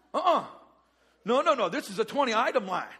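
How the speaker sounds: acted checkout-line indignation, loud; indignant. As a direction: protest the overfull express line.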